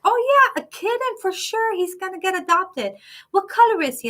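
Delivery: high, bright phone-voice imitation; chirpy shelter worker. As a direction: play an upbeat shelter clerk. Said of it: "they're like".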